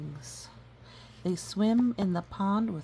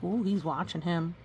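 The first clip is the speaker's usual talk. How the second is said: plain reading voice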